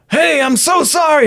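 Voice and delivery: high-pitched apologetic rocker on stage. Retold or imitated. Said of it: "I'm doing a great [name] impression".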